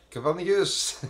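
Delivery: Copernicus! shouted out high, like an announcer's big reveal; grand announcer cry of Copernicus!; showy exclaim. Acted. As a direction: belt out Copernicus! with the first syllable high and the end ringing. Names crater, then repeats it.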